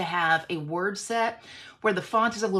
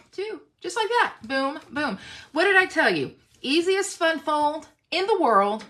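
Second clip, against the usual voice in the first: excited; 8 semitones higher, 6 dB louder than usual. Real, not acted. Showing off the easy fold.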